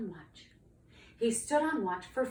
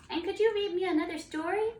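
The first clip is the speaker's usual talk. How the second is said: high, louder little dragon; eager young dragon; 9 semitones higher, 5 dB louder than usual. Acted. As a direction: chime in with a high plea. Second dragon asks for more.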